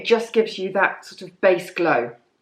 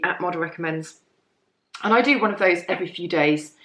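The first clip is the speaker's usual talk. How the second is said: normal talking voice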